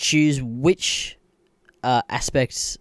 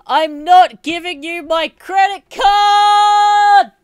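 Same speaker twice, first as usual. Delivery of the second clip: much higher and louder, slow, every word yelled; furious shout; 10 dB louder, much slower than usual. Real, not acted. Refusing Apple his card, a slow furious yell. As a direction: scream it at the company.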